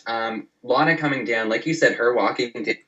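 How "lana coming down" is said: ordinary talk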